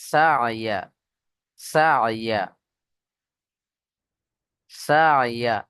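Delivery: সা ইয়া with a throaty ayn after sa; careful pronunciation demo. Shown as a demonstration. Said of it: "এই উচ্চারণটা দেখে নেন".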